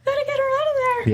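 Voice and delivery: very high, rapid squeaky put-on voice; Mara's voice. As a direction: voice Mara in a tiny high pitch. Voicing Mara, expected to attack.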